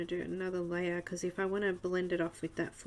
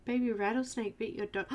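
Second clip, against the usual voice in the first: ordinary talk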